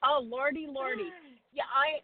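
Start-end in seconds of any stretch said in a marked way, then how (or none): none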